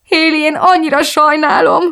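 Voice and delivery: high, distraught, tearful apology; breaking into sobs. A narrator's reading. Woman apologising to her friend as tears return, sobbing. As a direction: burst out as if starting to cry. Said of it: "törtem ki újra zokogásban".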